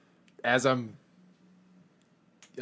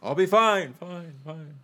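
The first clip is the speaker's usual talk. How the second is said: fading echo call; 5 semitones higher, 5 dB louder, much slower than usual. Acted. Calls out while vanishing, own echo.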